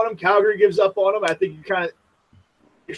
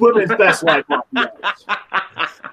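laughing; 3 semitones higher than usual. Real, not acted. Laughing while joking about Subban.

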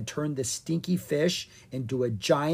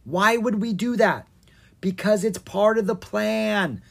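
arguing; 7 semitones higher, 5 dB louder than usual. Acted. Siblings argue in flight.

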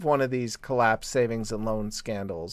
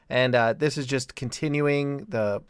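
ordinary delivery

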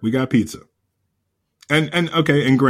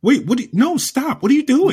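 high-pitched, incredulous protest; retold disbelief; 12 semitones higher than usual. Husband stops wife taking gym bagels, high mock disbelief. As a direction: act out stopping someone in alarm.

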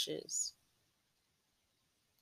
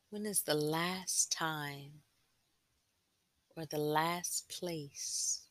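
guided meditation. Acted. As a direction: gently guide them inward, unhurried.

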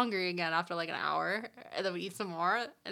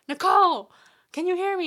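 loud, higher call to her friend; calling out across the room; 10 semitones higher, 11 dB louder than usual. Retold or imitated. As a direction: call out to Nicole far away. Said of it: "And I said".

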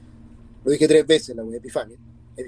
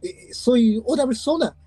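higher-pitched mock pleading; retold desperate plea; 6 semitones higher than usual. Man retelling his plea to the suffocating woman, mildly desperate. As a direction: retell a desperate protest, pitched up but not shouted. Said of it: "en mi desesperación, le decía".

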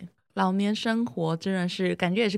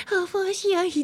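capybara voice; 11 semitones higher than usual. Pretend. Voicing a capybara at the window.

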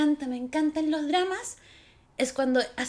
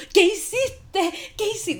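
high, drawn-out mock shriek; re-enacted fangirl outburst at the screen; acted outcry; 6 semitones higher, much slower than usual. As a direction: stretch it out slowly in high-pitched disbelief. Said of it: "Y yo así como".

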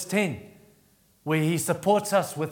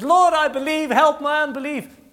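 much higher, louder pleading cry; desperate plea; 11 semitones higher, 5 dB louder than usual. Retold or imitated. Father pleading with Jesus for his son, desperate. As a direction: beg him with everything you have.

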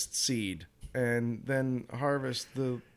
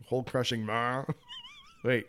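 normal talking voice

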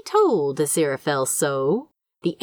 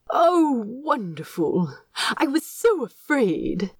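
higher, relieved angel voice with a catch; 7 semitones higher than usual. Acted. Aziraphale relieved the plant will live, relieved. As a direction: let out his relief.

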